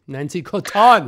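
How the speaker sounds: loud, slow delighted announcement of the name; excited exclaim. Real, not acted. A friend proudly announcing her former coworker. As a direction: call the name out with glee.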